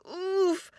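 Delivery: put-on small boy's straining push noise; effort grunt. Boy pushes against the ram, grunting oof. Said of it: "The boy pushed".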